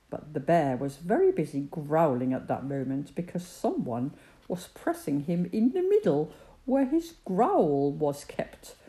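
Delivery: ordinary delivery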